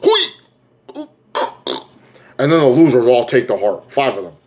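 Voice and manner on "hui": sudden very high squeal; mock gasp. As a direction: squeak as if the heart is torn out. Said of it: "And he's like".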